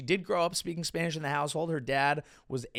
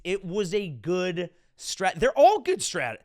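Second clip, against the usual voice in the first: ordinary delivery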